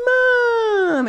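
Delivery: whiny high wail for mom; imitating a crying toddler; a kid wailing for her mom, mock crying. Crying kid voiced in story.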